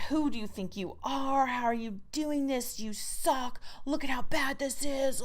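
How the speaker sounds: mock the harsh inner critic, lightly; nasty inner critic. Writer voicing the critic that slams them, mild sneering mockery. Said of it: "just slams you".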